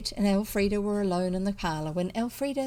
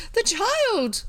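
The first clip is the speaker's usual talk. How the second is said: much higher, louder hysterical cry; 13 semitones higher, 6 dB louder than usual. A narrator's reading. Cousin Bet cries out for the child, hysterical. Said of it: "interrupted her hysterics".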